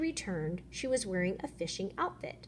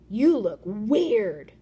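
loud, slow jeering insult; slow mocking taunt; 9 dB louder, much slower than usual. Fisherman taunts the fish, slow and loud. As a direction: drag out the jeer.

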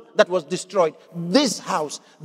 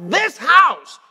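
"this house" said slowly, leaning hard on "this"; stress on THIS; 7 semitones higher, 5 dB louder than usual. Real, not acted. Preacher reading the verse to the congregation, emphatic stress on "this". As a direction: punch "this" high and drawn out before "house". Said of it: "I emphasized the word "this".

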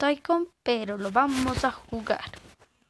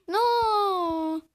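loud no; 5 semitones higher, 3 dB louder than usual. Real, not acted. Loud no in game.

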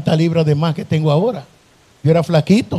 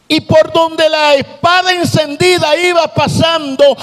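preaching at full force; 8 semitones higher, slower than usual. Acted. Preacher describing fleeing demons to the church, shouting.

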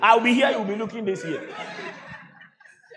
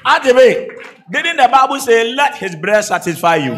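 loud, projected pulpit delivery; forceful preaching; 3 semitones higher, 12 dB louder than usual. Real, not acted. Preacher asks congregation about the Bible, forceful preaching. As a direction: preach it hard at the crowd.

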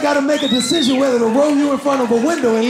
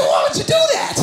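high outraged why. Retold or imitated. Outraged dad question.

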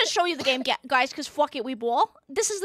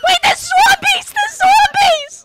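panicked shout; 12 semitones higher, 5 dB louder than usual. Real, not acted. Zombies appear in game.

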